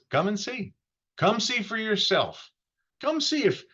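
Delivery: high, eager urging; eager invitation. Acted. Philip urging Nathanael to come see, high and eager. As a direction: pull him along, eager to show him. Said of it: "Philip says".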